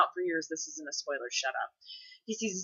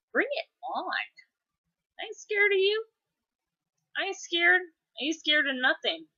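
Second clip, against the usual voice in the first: high, squeaky playful tough talk; cartoonish little challenger's defiance; mock bravado; 11 semitones higher than usual. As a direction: pretend to be fearless, pitched up. Playful bravado answering a threat.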